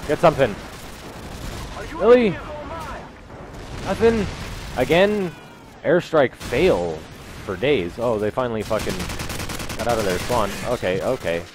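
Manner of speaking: usual speaking voice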